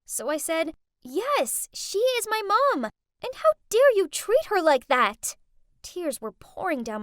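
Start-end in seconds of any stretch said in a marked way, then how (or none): 1.0-5.3 loud, higher angry defence; defiant anger. Acted. Girl standing up for her mom against her rich friends, angry.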